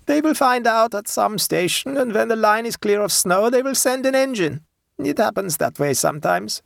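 put-on high German-accented peasant woman; unhurried high voice, German accent; German dialect. A narrator's reading. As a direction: play a German-speaking countrywoman, pitched high. Plays the German-speaking woman.

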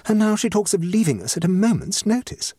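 lightly voiced aggrieved hostess; society hostess, aggrieved. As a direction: play a hostess airing her troubles.

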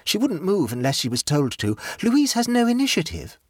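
a hint of a pleased, scatterbrained aunt; light, self-satisfied chatter; gaily. A narrator's reading. Jane's voice.

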